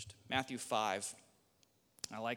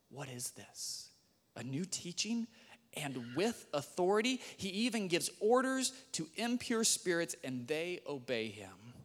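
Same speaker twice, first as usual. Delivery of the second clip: amazed; 7 semitones higher, 5 dB louder than usual. Acted. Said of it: "The people were all amazed".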